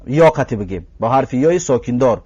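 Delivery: ordinary talk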